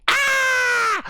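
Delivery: high piercing mock scream; girl's scream. Girl screams when the bathroom light dies, high shriek. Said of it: "the girl starts screaming".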